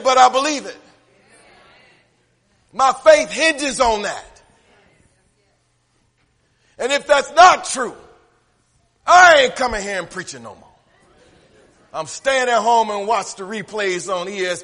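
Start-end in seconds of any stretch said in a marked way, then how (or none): 0.0-0.8 but I believe it shouted out, I hit hard; loud conviction. Real, not acted. Preacher declaring his faith to the church in a loud, forceful voice. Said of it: "I don't understand it". 2.7-10.7 louder, higher preacher cadence, building; full-force preaching. Acted. Preacher pressing the seed image on the church in a rising, louder voice.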